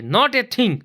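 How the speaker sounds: blurted out, high and loud; loud reply. Acted. Oop answering loudly and startling the others. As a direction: bark it out so they jump. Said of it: "उफ बोल रहा है loudly".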